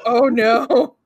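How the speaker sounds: loud, imitated cry of dismay; mock-horrified outburst; retold dismay. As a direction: re-enact being appalled, loudly. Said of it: "I just went".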